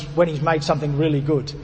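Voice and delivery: usual speaking voice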